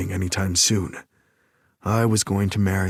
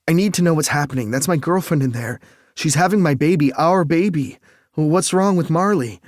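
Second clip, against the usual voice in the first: frantic, fast, high yelling; desperate shout for answers; screaming; 7 semitones higher, 5 dB louder than usual. Acted. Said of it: "I didn't realize I was screaming".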